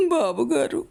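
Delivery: a lightly voiced wail to the master. A narrator's reading. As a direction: break into open weeping as you call. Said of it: "భోరున ఏడ్చింది రేవతి".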